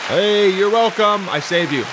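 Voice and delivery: smug you're welcome. Pretend. Mock self-congratulation.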